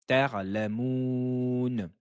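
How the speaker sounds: ta'lamoun very slowly, syllable by syllable with the Ain. Shown as a demonstration. Teacher drilling students on ta'lamoun, slow and careful. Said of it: "Entraînez-vous bien".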